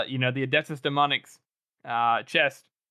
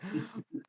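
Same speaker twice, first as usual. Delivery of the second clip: quick startled gasp, then the outburst; a hammed-up intake of breath; sharp mock gasp; 8 semitones higher, 12 dB quieter than usual. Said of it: "he's like".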